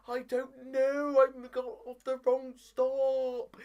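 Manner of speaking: high, slow, loud mock wail; stretched-out comic lament; hammed-up panic. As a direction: whine it out in exaggerated despair. Retold panicked phone call.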